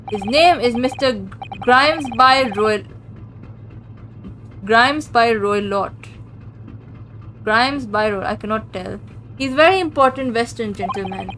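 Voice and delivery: put-on halting Russian accent. Stroganoff struggles with the passenger's name in a Russian accent.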